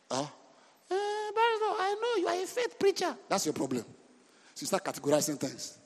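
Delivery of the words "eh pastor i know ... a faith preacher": high, soft mocking whiny voice; a squeaky imitation of a doubting churchgoer; mock listener voice. Preacher mimics a doubter in a high soft voice.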